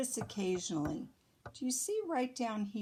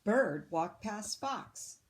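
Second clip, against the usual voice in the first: ordinary delivery, nothing marked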